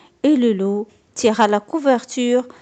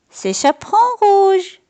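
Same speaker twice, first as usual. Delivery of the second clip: much higher, sweet little-girl imitation; wolf faking a girl's voice; 10 semitones higher than usual. A narrator's reading. Wolf at the door faking the girl's voice. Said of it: "répond le loup en imitant la voix de Petit Chaperon".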